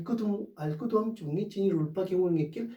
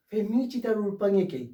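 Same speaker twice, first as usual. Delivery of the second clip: ordinary talk, nothing marked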